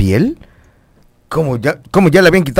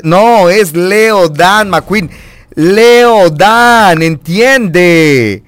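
"Leo Dan" drawn out, higher and louder on the repeat; emphatic correction; 4 semitones higher, 4 dB louder, much slower than usual. Acted. Host correcting the name in a vote, emphatic drawn-out "[name]". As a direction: stretch each syllable of "Leo Dan".

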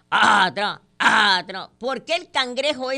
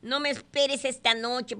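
mock child's yell; 8 semitones higher than usual. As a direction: call out high, like a boy coming home. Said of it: "Llega Luis a la casa gritando". (grito fingido de niño, agudo)

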